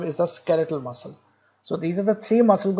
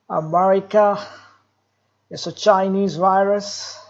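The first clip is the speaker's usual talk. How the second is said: mock Trump; slower than usual. Mimicking Trump's lines.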